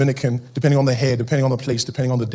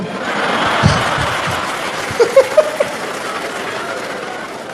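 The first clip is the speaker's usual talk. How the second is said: high airy laugh. Real, not acted. [name] laughs at the bit.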